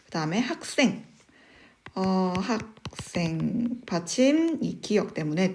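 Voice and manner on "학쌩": 학생 drawn out as 학-쌩, tense ㅆ; tensed consonant. Shown as a demonstration. Teacher showing the tensing rule, slow 학생 pronounced 학쌩. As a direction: say 학생 slowly as 학쌩. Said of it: "이 시옷이 된소리로 발음되는".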